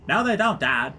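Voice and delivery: high-pitched daughter contradicting dad; put-on daughter voice. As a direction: play a daughter arguing on the phone. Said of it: "I better call my daughter".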